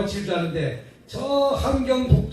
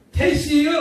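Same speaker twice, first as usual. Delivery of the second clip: Chungcheong dialect, slightly higher; 5 semitones higher, 4 dB louder than usual. Retold or imitated. Preacher showing the congregation Chungcheong speech. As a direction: drawl it the Chungcheong way. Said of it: "충청도 오니까".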